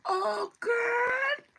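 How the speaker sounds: very high, loud mock wail; exasperated server. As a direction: squeal it in exaggerated exasperation. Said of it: "And they be like".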